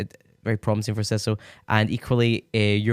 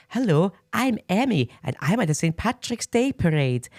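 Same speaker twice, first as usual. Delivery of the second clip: high-pitched mimicked singer introducing herself; 9 semitones higher than usual. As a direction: put on her high, chirpy hello. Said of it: "So seeing her like".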